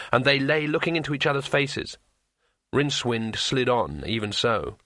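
ordinary delivery, nothing marked

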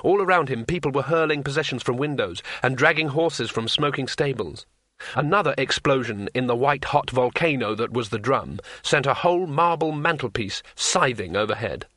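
ordinary delivery, nothing marked

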